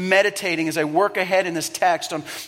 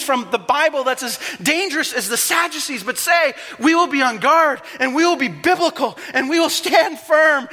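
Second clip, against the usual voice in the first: high, loud urgent sermon climax; impassioned preaching; 6 semitones higher, 4 dB louder than usual. Acted. Pastor urges believers to stay on guard, preaching at full force. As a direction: plead with the church to wake up.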